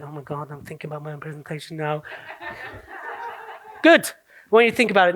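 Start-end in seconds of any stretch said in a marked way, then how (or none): 0.0-2.0 very soft, low, quick aside; hushed, hurried acted thinking-to-oneself; mock private worry. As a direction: imitate an anxious inner thought under the breath. Acts out audience's inner thought.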